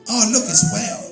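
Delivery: imitating masseuse. Masseuse greeting him as Whale.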